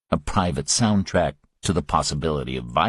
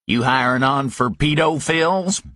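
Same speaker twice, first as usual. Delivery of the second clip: higher Southern sneer; 7 semitones higher than usual. Acted. Griffin mocking Parker, higher Southern sneer.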